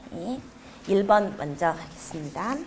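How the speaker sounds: ordinary delivery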